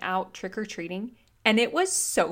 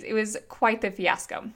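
normal talking voice

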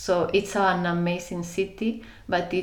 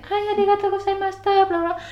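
high, fast, sing-song Japanese greeting; bright, rapid bowing greeting; imitating lift attendant; 14 semitones higher, 5 dB louder, much faster than usual. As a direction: chirp it like a polite lift attendant. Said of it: "bowed to them".

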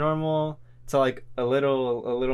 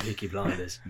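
low, soft British rasp; put-on low British voice; harsh British voice; 9 semitones lower, 8 dB quieter than usual. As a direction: drop it low for a British tough. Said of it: "a harsh British voice".

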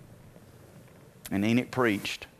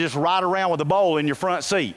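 ordinary talk, nothing marked

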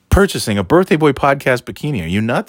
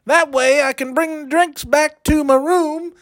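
put-on much higher, sly party-boy voice; high-voiced schemer; 12 semitones higher than usual. Bragging to Cliffy about drink passes and ladies, very high sly voice. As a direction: crank the pitch way up and brag slyly.

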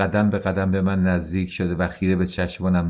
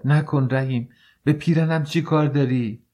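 pleading shout; 7 semitones higher than usual. A narrator's reading. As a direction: cry out in alarm. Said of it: "فریاد زد". (فریاد زن که نکن رحیم)

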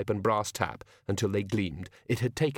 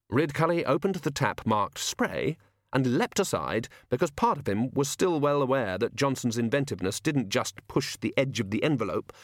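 ordinary delivery, nothing marked

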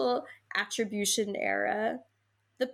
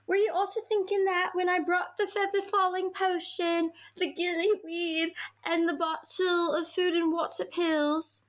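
higher-pitched put-on storybook voice; fanfiction character's voice; 8 semitones higher than usual. Reading the questioning character's line to a co-host, high put-on voice.

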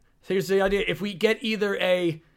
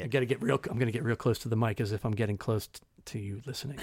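right in your ear; 8 semitones lower, 7 dB quieter, faster than usual. Shown as a demonstration. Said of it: "It's right in my ears".

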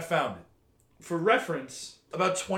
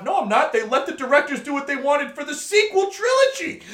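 much higher, louder indignant protest; heated rant; 10 semitones higher, 4 dB louder than usual. Real, not acted. Ranting to his co-host about a friend's Star Wars take, higher and louder in indignation. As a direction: push back hard, voice climbing.

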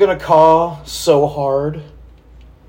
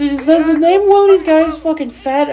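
high-pitched outburst; 14 semitones higher than usual. Real, not acted. Reacting to bad wrestling footage.

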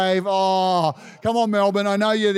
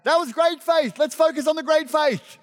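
Peter pleading higher, a mock whine; whiny Peter excuse; 6 semitones higher than usual. Peter protesting to Jesus after sinking, mock whine. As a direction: plead your case up high, half-joking. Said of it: "I would have been like".

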